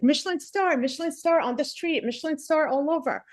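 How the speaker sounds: high-pitched imitation of gushing reviewers; mock raving. Mimicking everybody's raving reviews.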